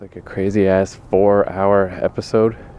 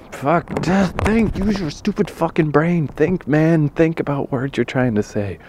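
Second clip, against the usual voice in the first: frustrated self-scolding; 9 semitones higher than usual. Real, not acted.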